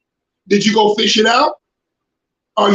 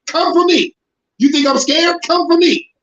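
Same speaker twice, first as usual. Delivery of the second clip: defiant dare; 6 semitones higher than usual. Real, not acted. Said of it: "Yeah, I said it".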